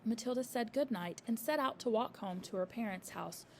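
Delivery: ordinary delivery, nothing marked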